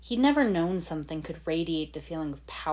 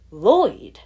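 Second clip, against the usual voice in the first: much louder, ringing exclamation of Lloyd; exclaimed; 4 semitones higher, 12 dB louder than usual. Acted. Lloyd stunned by hearing his name, loud exclamation. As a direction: call out Lloyd in shock.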